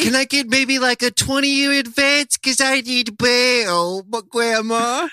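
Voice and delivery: put-on high, slow pleading little kid; high kid voice pleading for bail; whiny kid voice. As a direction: beg grandma like a small child. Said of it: "how Adam sounded as a two-year-old".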